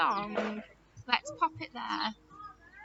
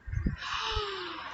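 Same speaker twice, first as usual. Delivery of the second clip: long, soft gasp of pretend surprise; mock surprised gasp; 5 semitones higher, 6 dB quieter than usual. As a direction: gasp as if amazed by the answer. Teacher reacts to guessed answer.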